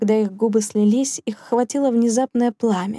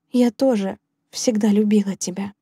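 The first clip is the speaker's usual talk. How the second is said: usual speaking voice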